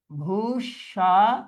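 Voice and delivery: ভূস্বামী slowly as bhush, sha, mi; syllable by syllable. Shown as a demonstration. Teacher dictating for students, syllable by syllable. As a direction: stretch ভূস্বামী into separate beats.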